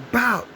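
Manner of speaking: high shouted bow; gunshot bow. Retold or imitated. Bullet to the chest, high shouted bow. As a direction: bang it out high.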